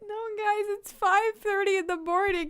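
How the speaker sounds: laughing. Real, not acted. Laughing at her own reading.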